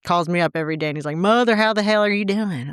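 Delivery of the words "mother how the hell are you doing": mock Southern twang, pitched up; Southern accent impression. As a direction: imitate a son's thick Southern accent. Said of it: "he talks just like that".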